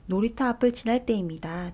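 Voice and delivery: ordinary delivery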